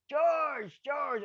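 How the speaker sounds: high, loud call across the crowd; loud high call to George. Retold or imitated. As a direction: shout his name to get his attention. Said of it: "my pal Gibbo".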